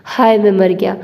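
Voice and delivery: ordinary delivery, nothing marked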